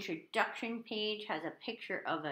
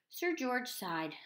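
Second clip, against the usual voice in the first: plain reading voice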